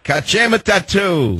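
high, drawn-out "Kajima tattoo", copying the caller; playful Gaelic imitation. Host tries caller's phrase again.

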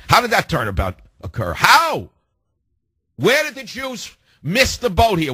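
high-pitched incredulous outburst; exasperated disbelief. Real, not acted. Host asking how leadership came to call for war, exasperated disbelief. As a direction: voice jumps way up in disbelief. Indignant rhetorical questions on air.

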